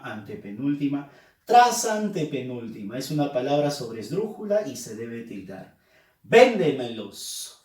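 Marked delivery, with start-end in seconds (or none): 6.2-7.6 "Véndemelos" slowly, strongest on "Vén"; stress on VÉN. Shown as a demonstration. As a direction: punch the first syllable, "VÉN-de-me-los". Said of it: "Fuerza de voz en ven".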